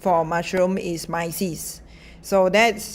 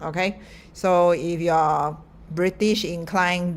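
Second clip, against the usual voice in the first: usual speaking voice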